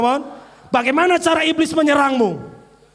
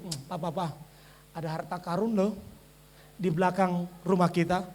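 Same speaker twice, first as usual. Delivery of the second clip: much lower and softer, hesitant; timid whisper-soft; 8 semitones lower, 10 dB quieter than usual. Retold or imitated. Wife unsure telling husband of treasure, timid murmur. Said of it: "Ada nggak saudara ngomong begini".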